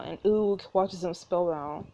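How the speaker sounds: usual speaking voice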